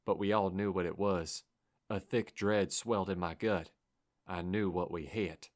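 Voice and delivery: ordinary delivery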